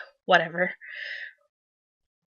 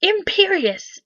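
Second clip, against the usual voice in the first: shouted spell; 8 semitones higher, slower than usual. A narrator's reading. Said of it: "But then Voldemort shouted".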